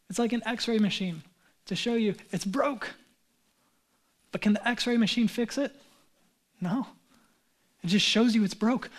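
ordinary talk, nothing marked

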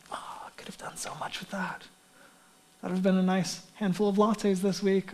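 hushed, wistful sigh over lost money; soft, grudging near-whisper; regretful giver. Acted. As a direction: mutter it under your breath, reluctantly. Voices a reluctant giver sighing over money.

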